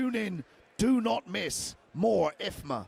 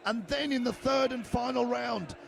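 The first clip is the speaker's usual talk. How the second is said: energetic live fight call; play-by-play commentary. Real, not acted.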